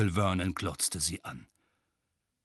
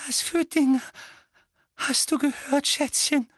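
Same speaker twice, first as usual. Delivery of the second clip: high, anxious Petunia voice; Petunia breathing; 5 dB louder than usual. A narrator's reading. Aunt Petunia asks her son, breathless and anxious. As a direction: ask gently and fearfully. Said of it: "hauchte Tante Petunia".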